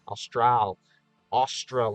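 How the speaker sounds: "Austral" with a clear AL at the end, like astral; sound out the AL. Shown as a demonstration. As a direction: stress the L in "Austral". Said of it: "A L, out loud".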